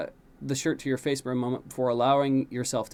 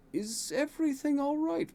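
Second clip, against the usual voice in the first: much higher concerned question; worried guard. A narrator's reading. Guard calling after the rushing prince, worried. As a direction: ask anxiously as he rushes by.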